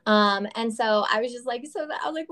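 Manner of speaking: ordinary delivery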